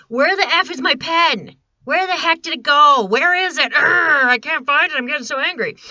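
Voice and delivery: exasperated growl over a lost pen; mock anger. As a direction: stomp around hunting the pen, furious. Anger stage, acting lost-pen rage.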